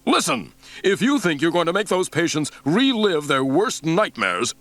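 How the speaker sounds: angry outburst. A narrator's reading.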